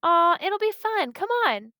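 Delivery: mildly acted kid's pleading; coaxing. A narrator's reading. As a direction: wheedle a reluctant friend. Jessie coaxing a bored friend.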